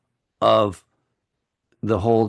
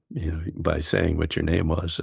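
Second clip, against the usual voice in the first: ordinary delivery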